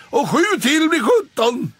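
high, loud sing-song mockery; high jeering voice; teasing cat Bull. A narrator's reading. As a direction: add to the cruel joke. Bull teases Pelle.

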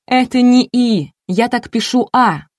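громко и высоко, с нажимом; втолковывать сердито, чеканя буквы; раздражённо. A narrator's reading. Энн сердито поясняет свой почерк. (раздражённое громкое объяснение)

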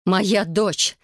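plain reading voice

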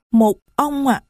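put-on little girl's voice. Little Marie complains to the stranger, high child voice. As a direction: speak as a little child who is hurt.

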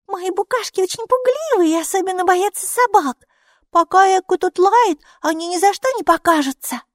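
put-on high, bright little girl. A narrator's reading. Girl explaining herself to the neighbour, childlike. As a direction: play the small girl explaining.

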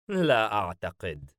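light dismissive scoff; a touch of mockery; mocking. A narrator's reading. Demon doubting his comrade's boast, lightly mocking. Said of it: "حاييم باستهزاء".

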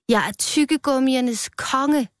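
high, slow storybook proclamation; proclaiming cry. A narrator's reading. Rød raises his arms to the strawberries, proclaiming. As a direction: call it out high, taking your time. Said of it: "løftede sine arme og råbte".

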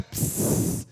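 long hissed "pss"; burst pipe seal. Retold or imitated. Showing how the gasket breaks, long spraying hiss. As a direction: imitate water spraying from a broken seal. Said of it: "de agua se rompe".

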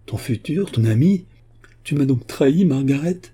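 high, loud incredulous questions; shocked accusation. A narrator's reading. Father believes his daughter betrayed him, shocked accusation. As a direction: accuse her in disbelief.

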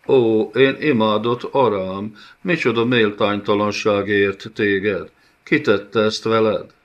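mock pity. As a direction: pretend to grieve, dripping sarcasm. Villain mocks bound princess. (gúnyos, álszent sajnálkozás)

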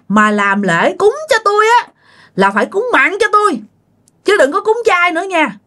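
high-pitched, insistent ghostly warning; stern demand. Acted. Possessing spirit ordering the widow about future offerings, stern and threatening. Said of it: "mới chỉ vô mặt của bà Hạnh".

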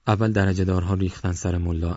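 usual speaking voice

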